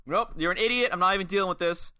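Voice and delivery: high, dismissive mock refusal; angry girlfriend. Angry girlfriend done with Money, mock dismissal. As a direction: shut them down like a fed-up girlfriend. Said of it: "an angry girlfriend".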